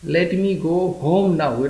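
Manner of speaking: very slow, careful teacher read; slow model sentence. Shown as a demonstration. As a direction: lay each word out slowly for learners. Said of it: "teacher models English sentence". (ધીમે ધીમે સ્પષ્ટ ઉચ્ચાર)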